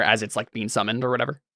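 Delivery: ordinary talk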